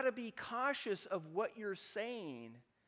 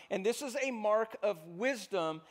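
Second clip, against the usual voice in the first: ordinary delivery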